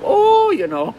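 high, lilting Irish accent put on. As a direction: imitate your best Irish accent at a party. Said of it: "I put on my best Irish accent".

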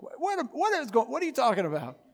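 high-pitched, stammered disbelief; comically confused, voice jumping high; mock bafflement. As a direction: act out being thrown by what was just said. Acts his baffled reaction to prayer.

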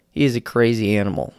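ordinary talk

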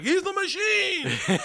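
very high, slow, laughing brag; slow proud boast. Retold or imitated. Igor bragging to the crowd about his friend, slow high boast. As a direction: stretch it out proudly. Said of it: "And Igor's like".

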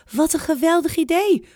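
high, bright cry of delight; grandma loves the idea, high and delighted; delighted exclamation. Acted. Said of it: "roept oma".

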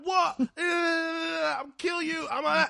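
high, shouted mock fury; cartoon rage. Retelling a Boondocks man's outburst, high mock shout. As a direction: blow up like a cartoon hothead. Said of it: "he starts to".

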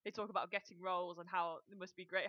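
ordinary delivery

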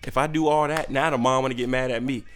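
ordinary delivery

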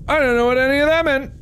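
very high, squeaky outburst of confusion; baffled squeal. Real, not acted. Baffled after reading the note.

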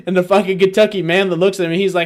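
ordinary talk

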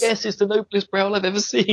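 put-on high, gushing boy voice; hammed-up high Mark; mock Mark. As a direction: squeak out the flattery. Mark voice quoted.

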